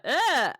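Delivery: loud, high "ugh!" of revulsion; sudden loud disgusted groan; re-enacted shout of disgust. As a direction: shout out a visceral, grossed-out yelp. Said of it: "I literally shouted, like".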